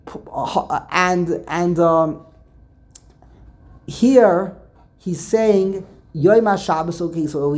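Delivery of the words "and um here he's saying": usual speaking voice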